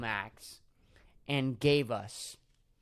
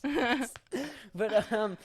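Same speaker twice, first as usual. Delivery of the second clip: laughing; 7 semitones higher than usual. Real, not acted. Laughing while talking.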